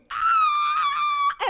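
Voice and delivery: sudden high piercing shriek; a loud high yelp of pain; retold scream. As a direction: shriek as if stung. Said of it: "and I screamed".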